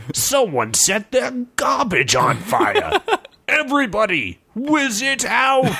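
shouting. Acted. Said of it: "shouted a veteran adventurer".

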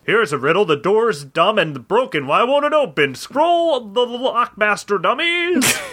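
building from taunt to high yell; hammed-up angry rant, ending high; mock yell. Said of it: "yelled Biff".

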